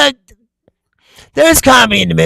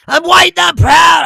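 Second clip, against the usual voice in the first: high, loud mock chant; hammed-up defiant declaration; mock proud shout; 12 semitones higher, 7 dB louder than usual. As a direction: belt it like a rally slogan. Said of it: "if I say".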